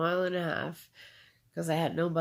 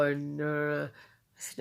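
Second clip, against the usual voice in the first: ordinary delivery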